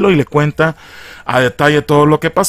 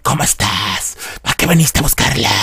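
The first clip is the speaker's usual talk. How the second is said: voz ronca. Acted. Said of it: "con esta voz ronca sí me sale bien".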